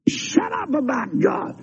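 fed-up sinner throwing a fit; pitching a fit. Retold or imitated. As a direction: act out a sinner's tantrum. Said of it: "You pitch a fit and say".

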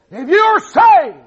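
high, loud, slow pulpit shout; shouted challenge. Real, not acted. Preacher challenging the saved, shouted challenge. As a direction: throw it out hard at the congregation.

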